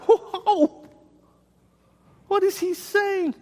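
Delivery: high, astonished double take; astonished whoa. Retold or imitated. Listener shocked by what Jesus says, high astonished voice. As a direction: gasp it out in high disbelief. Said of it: "it's like".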